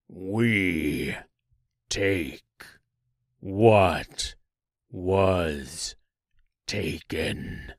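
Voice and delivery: low, very slow monster voice, long gaps between words; deep menacing tree. A narrator's reading. Tree holding Dorothy declares its revenge, deep and menacing. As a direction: intone each word deep and slow, sinking at the end. The giant tree speaks again.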